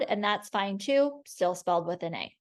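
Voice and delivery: ordinary talk, nothing marked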